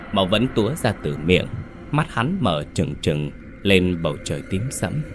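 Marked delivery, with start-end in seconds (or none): none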